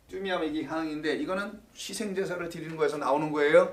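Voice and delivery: ordinary talk, nothing marked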